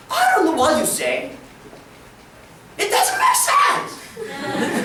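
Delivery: very slow, drawn-out dismissive English; mock teen feigning no Korean. Teens pretending not to understand Korean, drawn-out dismissive English. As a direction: play dumb in slow English. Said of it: "뭐 이러면서 애들이 막 그러요".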